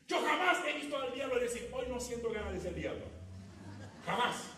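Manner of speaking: loud, high, full-force preaching. Acted. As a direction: thunder it from the pulpit. (predicación a todo pulmón)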